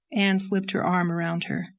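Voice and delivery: plain reading voice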